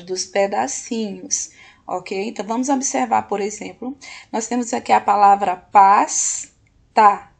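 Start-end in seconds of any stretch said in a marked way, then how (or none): 5.5-7.3 PASTA split as PAS... TA with a pause between; teacher breaking PASTA into PAS and TA; syllable by syllable. Shown as a demonstration. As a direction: sound out PASTA in two separate beats, holding the S. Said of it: "A primeira sílaba".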